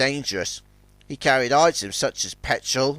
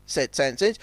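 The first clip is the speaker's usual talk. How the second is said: ordinary delivery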